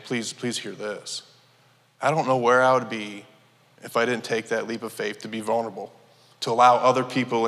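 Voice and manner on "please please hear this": slow, softer heartfelt plea; a quiet, sincere appeal to the listeners; earnest plea. Real, not acted. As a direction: lean in and ask them gently. Testimony plea to congregation.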